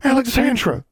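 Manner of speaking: high-pitched delighted cry of a name; excited exclamation. A narrator's reading. Twins turning round to greet their friend, exclaiming. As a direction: exclaim it loudly in a girlish squeal.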